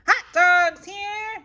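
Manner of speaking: loud, high, drawn-out vendor call; vendor's yell. Acted. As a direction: hawk hot dogs to the stands. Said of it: "yells a boy".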